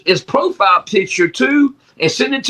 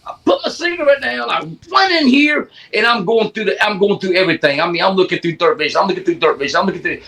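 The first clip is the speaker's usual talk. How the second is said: usual speaking voice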